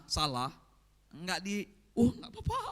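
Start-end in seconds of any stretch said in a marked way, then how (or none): 2.0-2.6 indulgent parent. Retold or imitated. Preacher mocks a parent who lets wrong slide, high put-on voice.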